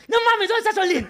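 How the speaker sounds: excited fans. Retold or imitated. Fans ask for Solín.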